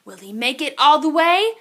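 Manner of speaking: ordinary delivery, nothing marked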